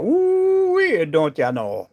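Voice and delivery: high whooped Cajun holler, then down; hammed-up Cajun exclamation; playful Cajun. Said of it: "I'm the crazy Brazilian American Cajun".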